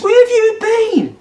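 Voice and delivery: squeaky high mock girlfriend voice, slow; cartoonish high-pitched girlfriend demanding answers; girlfriend's angry question. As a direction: lampoon the girlfriend as shrill and indignant. Girlfriend voiced in heated quarrel.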